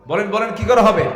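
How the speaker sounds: loud, high repeated question; insistent demand. Real, not acted. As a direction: press the crowd to answer. Repeats question pressing for answer. (জোর গলায় জবাব চাওয়া)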